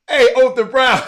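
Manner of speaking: high, very loud holler of hello; real high-pitched happy yell; excited greeting shout. Real, not acted. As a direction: shout the name in delighted surprise. Greeting someone who turns up.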